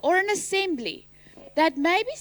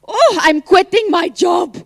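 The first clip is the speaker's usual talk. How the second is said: sudden loud joking outburst; mock shout; 11 dB louder than usual. Teacher hams up a student quitting in a loud burst. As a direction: blurt it out like a fed-up worker.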